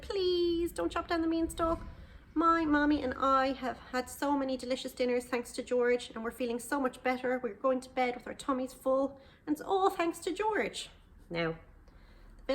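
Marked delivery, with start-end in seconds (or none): 0.0-10.9 put-on high, earnest childlike plea; Jack pleading. Jack telling villagers how George fed them. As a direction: plead sweetly for his friend.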